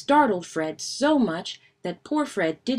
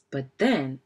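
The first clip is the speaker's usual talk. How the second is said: usual speaking voice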